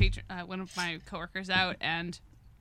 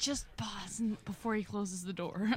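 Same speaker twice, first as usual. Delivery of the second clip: slow, hushed mock freeze; pretend whisper; 4 dB quieter, slower than usual. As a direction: whisper it slowly, as if holding still. Acting out freezing as stuffed animals.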